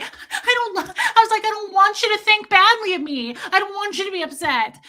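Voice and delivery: very high hammed-up wail; upset. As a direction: plead in a loud mock-tearful squeal. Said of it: "I'm upset".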